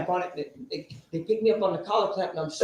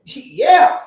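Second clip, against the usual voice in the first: eager; 8 semitones higher, 11 dB louder than usual. Retold or imitated. Eager reply to the offer.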